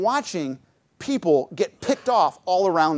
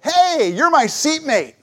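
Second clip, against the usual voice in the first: higher, slow, overly cheerful greeting; lightly voiced friendly airline passenger; eager stranger; 10 semitones higher, slower than usual. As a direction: play the chatty seatmate happy to meet him. Imitating eager stranger on plane.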